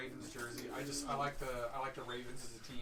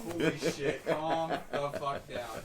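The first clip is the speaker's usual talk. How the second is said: mock exasperated; 6 dB louder, much slower than usual. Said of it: "And I'm like".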